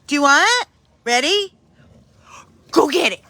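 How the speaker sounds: ordinary talk, nothing marked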